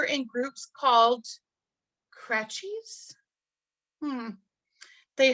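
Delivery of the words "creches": "creches" as KRETCH-eez, higher and questioning; unsure pronunciation. Real, not acted. Teacher meeting an unknown French word, unsure pronunciation. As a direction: say "creches" softly with a hard tch, rising doubtfully. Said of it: "I'm not sure I pronounced that correctly".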